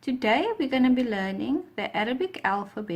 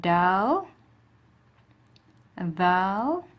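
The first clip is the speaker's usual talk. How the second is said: clear letter recitation; 3 semitones higher, much slower than usual. Shown as a demonstration. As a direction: recite the alphabet for students to repeat. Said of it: "repeat after me".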